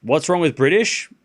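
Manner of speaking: ordinary talk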